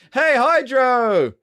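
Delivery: loud, high, drawn-out hello; excited greeting. Real, not acted. Streamer welcoming a viewer who just arrived, excited greeting. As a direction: call it out long and high.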